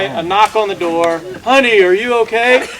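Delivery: usual speaking voice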